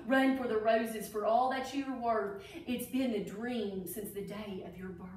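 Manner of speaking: lilting rhyming storybook read; reading to children. Acted. Teacher reading a Derby alphabet book to children, storybook voice. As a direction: read each verse like a picture book for kids.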